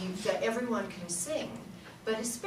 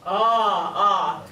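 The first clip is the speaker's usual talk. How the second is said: louder, deep ah; low larynx ah; 3 semitones lower, 6 dB louder than usual. Shown as a demonstration. Teacher demonstrating the low-larynx sound, deep ah. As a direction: sing ah on a dropped larynx. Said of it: "A low larynx is where we want to make sound".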